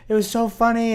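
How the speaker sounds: imitating girlfriend. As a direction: chirp it like she did. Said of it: "Emma was like".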